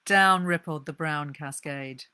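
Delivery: ordinary delivery, nothing marked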